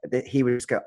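ordinary talk

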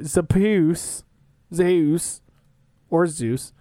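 trying Zeus several ways; fumbling the name Zeus; unsure of Zeus. Shown as a demonstration. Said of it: "Just say Zeus".

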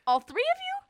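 high, loud disbelieving question; mock shock at a silly answer; incredulous. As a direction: squeak it out in disbelief. Retold dialogue, incredulous question.

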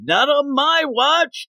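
high, slow, hammed-up hero line; heroic vow. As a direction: draw out a cheesy hero's vow. Heroine vows to stop escape.

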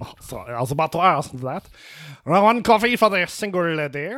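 low, hammy French waiter voice; French accent. Acted. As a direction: put on a thick French accent. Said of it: "I am losing this accent".

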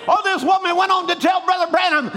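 rising preacher's cry, building; preaching. Acted. Preacher exhorting the congregation, rising fervent cry. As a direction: proclaim it to the congregation.

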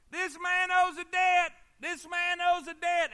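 high, slow, very loud proclamation; acted public hounding. Hired tormentors hound a debtor outside his house, shouting accusingly. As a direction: shout it out like a town crier.

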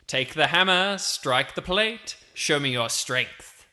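commanding announcer, starting high, slowly drawn out; echoing carnival king. Acted. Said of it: "King Carnival's voice again echoing from somewhere above you".

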